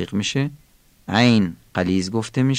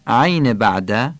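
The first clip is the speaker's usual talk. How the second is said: normal talking voice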